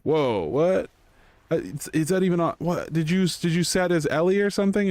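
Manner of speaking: high, incredulous retold question; mock disbelief. Guildmates baffled that a healer won it, incredulous. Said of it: "and people were like".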